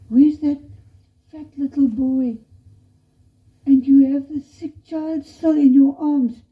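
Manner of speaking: high, loud, insistent questioning; appalled confrontation. Acted. Missionary challenges a mother who sacrificed her child, appalled.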